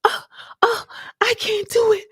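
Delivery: high, crying plea; mock sobbing breakdown; breaking down crying. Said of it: "the sister breaks down".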